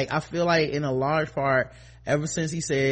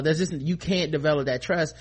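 ordinary delivery

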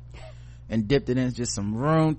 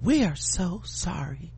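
mock automated voice; 3 semitones higher, 6 dB quieter, slower than usual.

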